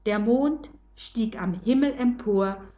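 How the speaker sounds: ordinary delivery, nothing marked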